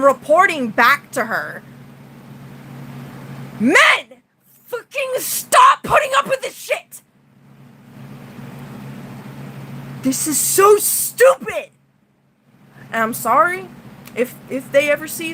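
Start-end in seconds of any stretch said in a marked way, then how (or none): 3.6-11.7 high, strained shouting at men; real angry outburst, voice cracking; furious rant. Real, not acted. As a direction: yell it out, fed up and furious. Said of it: "I'm really pissed off".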